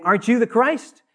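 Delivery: scoffing, high and loud with a rising finish; sarcastic. Acted. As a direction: jeer it as a challenge, pitch climbing to the end. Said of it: "the first criminal sarcastically saying".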